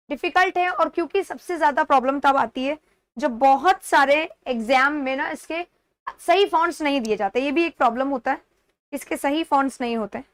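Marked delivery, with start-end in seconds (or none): none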